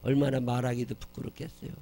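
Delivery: ordinary talk, nothing marked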